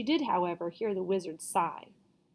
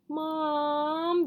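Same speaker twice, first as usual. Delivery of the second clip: high whine; girl whining for Mom; 7 semitones higher, 4 dB louder than usual. A narrator's reading. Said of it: "the girl whined".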